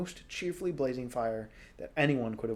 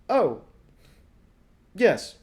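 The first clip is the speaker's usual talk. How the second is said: startled; 6 semitones higher, 11 dB louder than usual. Acted. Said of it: "he said".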